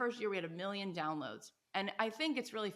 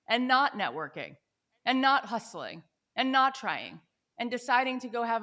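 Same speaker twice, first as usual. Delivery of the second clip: each not punched louder in turn; emphatic repeated not; 7 dB louder than usual. Real, not acted. To the interviewer, stressing what she didn't do, emphatic. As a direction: hammer every not in the list. Building a point about not hustling.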